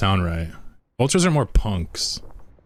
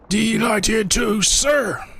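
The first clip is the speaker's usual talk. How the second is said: high, drawn-out shouted unit reply; game unit voice; 12 semitones higher, much slower than usual. Acted. Streamer practicing StarCraft voice lines, high game-unit shout. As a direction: shout it high and stretched out, like an eager soldier. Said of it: "practice my StarCraft voice lines".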